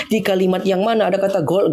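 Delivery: normal talking voice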